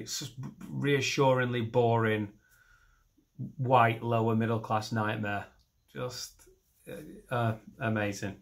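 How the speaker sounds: ordinary talk, nothing marked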